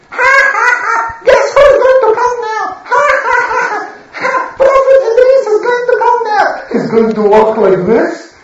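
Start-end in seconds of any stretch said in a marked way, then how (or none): 0.1-6.5 mocking laughter. Gang jeering at Prophet Idris, shrill mocking laughter. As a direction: cackle to mock the prophet. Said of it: "they mock him".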